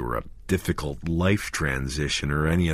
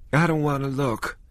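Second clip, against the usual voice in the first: high, frightened plea; fearful, not wanting to look; frightened woman; 9 semitones higher than usual. A narrator's reading. Vicky frightened at the body.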